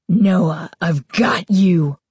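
slow, deep, raspy monster voice; deep raspy evil voice. A narrator's reading. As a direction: drag it out low and evil. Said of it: "her voice deep, raspy, evil".

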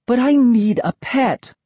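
put-on high-pitched, pleading kid; insisting. A narrator's reading. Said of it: "I insisted".

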